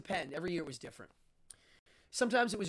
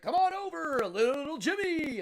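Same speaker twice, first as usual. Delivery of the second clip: host in a suit; 9 semitones higher, 5 dB louder than usual. Retold or imitated. Suited host calling a child onstage, showy emcee voice. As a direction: welcome the kid like a game-show host. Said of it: "He's like".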